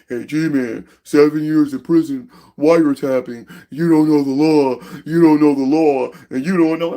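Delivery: slow, drawn-out mock warning; mocking imitation. Imitating people constantly coming to G-Man, mockingly drawn out. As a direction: drag out each phrase like a tiresome chant.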